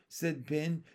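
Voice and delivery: ordinary delivery, nothing marked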